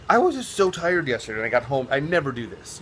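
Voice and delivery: usual speaking voice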